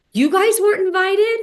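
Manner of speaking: mock shock. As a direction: overplay the shocked innocent question. [name] acts shocked at the news.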